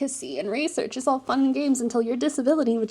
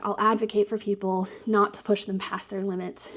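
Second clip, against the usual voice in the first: ordinary talk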